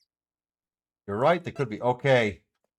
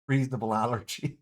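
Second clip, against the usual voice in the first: usual speaking voice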